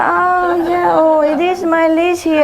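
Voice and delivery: a hint of the neighbor's put-on surprise; mock neighbor finding leash. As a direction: pretend to just find the leash. Neighbor lady voiced.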